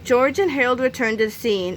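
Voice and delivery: ordinary delivery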